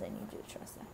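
hushed message from spirit; whispered. Acted.

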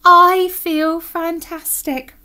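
high, loud, drawn-out delight; blissful relief. Acted. Alan relieved after winning the cup, blissful. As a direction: stretch it out with huge relief.

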